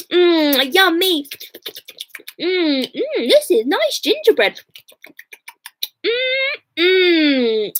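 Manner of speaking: high, eating sounds with mms; munching with pleasure. Acted. The children nibbling the walls, mouthful of gingerbread.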